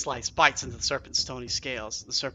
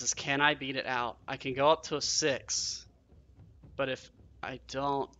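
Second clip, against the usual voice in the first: ordinary delivery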